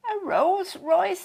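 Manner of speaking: high, loud and slow, in amazed delight; kids whooping. A narrator's reading. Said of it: "the kids whooped".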